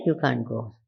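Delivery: low, quiet, unhurried refusal from the master; the guru calmly tells the devotee he can't go, low and settled; calm guru's command. Retold or imitated. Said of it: "He said".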